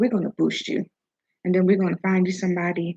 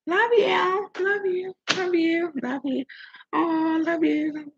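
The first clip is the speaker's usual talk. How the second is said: mocking; 10 semitones higher, much slower than usual. Mocking people who say love lightly.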